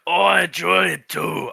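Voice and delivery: slow gravelly creature greeting; drawn-out gravelly tree voice. Acted. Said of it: "I keep giving them all gravelly voices".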